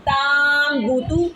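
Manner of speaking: ताम बूतू with a long drawn AA in ताम; ताम बूतू chanted slowly on an over-long first vowel; wrongly stretched vowel. Shown as a demonstration. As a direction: drag out the ता slowly, then बूतू. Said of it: "आप इसको ऐसे नहीं पढ़ेंगे".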